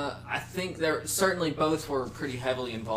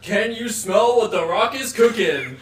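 slow, loud, growled catchphrase; Rock impression; 7 semitones higher, 8 dB louder, slower than usual. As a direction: boom it out like a wrestler. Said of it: "do a rock impression".

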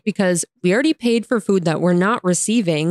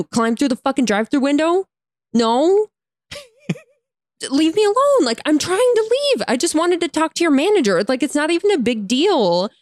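retold exasperation; 6 semitones higher than usual. Acting out her exasperated retort.

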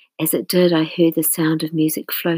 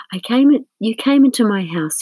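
higher, louder wounded protest; aggrieved complaint; 5 semitones higher, 5 dB louder than usual. A narrator's reading. Hurt friend accuses the narrator, voice raised in reproach. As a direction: lay out the grievance with hurt indignation.

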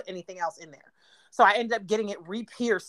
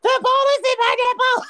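high-pitched panicked shout; shrieked, losing it; freaking out; 13 semitones higher, 6 dB louder than usual. Retold or imitated. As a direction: yell it in a full freak-out. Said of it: "I'm freaking out".

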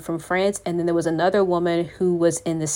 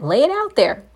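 urgent encouragement; 7 semitones higher than usual. Real, not acted. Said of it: "urging the listener, repeated".

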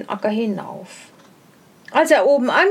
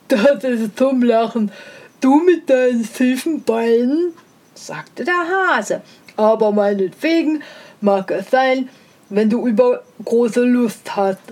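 a lightly voiced sneering hare; scornful hare. A narrator's reading. The hare mocks the hedgehog's crooked legs, lightly sneering. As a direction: look down on him as a lightly voiced smug hare.

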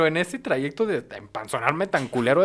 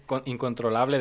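ordinary talk

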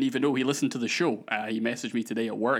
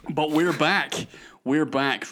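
triumphant and louder; excited; 5 semitones higher, 6 dB louder, slower than usual. Real, not acted. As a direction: announce the comeback with glee. Excited return.

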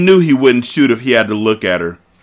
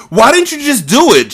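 shrill, much higher, loud outburst; shouted; 6 dB louder than usual. Acted. Mia shouting at Carter after the shot, in a high loud voice. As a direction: yell it at him, half out of your mind with fright. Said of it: "she shouted".

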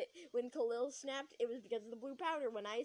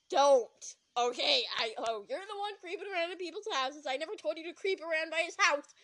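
loud, raised-pitch tirade; angry scolding; 3 semitones higher, 7 dB louder than usual. Acted. Accusing Ruth of creeping around houses, angry scolding. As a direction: fire back accusations at full volume.

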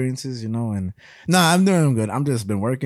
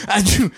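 high, mocking achoo; fake dainty sneeze; 8 semitones higher, 5 dB louder than usual. Mocking a lady's sneeze at home, fake dainty sneeze. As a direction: put on a phony little sneeze. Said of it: "you don't sneeze like that".

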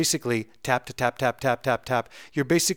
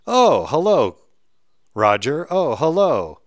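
higher, louder delighted oh hello; cheery phone greeting; 4 semitones higher, 6 dB louder, slower than usual. Pretend. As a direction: greet the caller with hammed-up delight.

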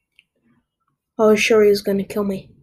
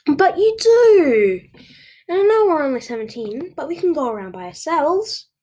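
teasing protest; 8 semitones higher, 5 dB louder than usual. A narrator's reading. Playful quarrel with boyfriend.

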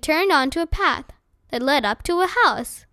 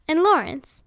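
usual speaking voice

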